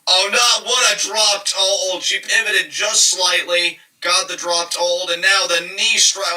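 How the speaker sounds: high excited play-by-play; excited shout. Real, not acted. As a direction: call it with rising excitement. Big wrestling move, excited call.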